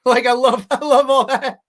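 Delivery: high, loud words through laughter; laughing. Real, not acted. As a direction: crack up while talking. Cracking up at his own impression.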